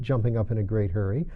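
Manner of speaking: usual speaking voice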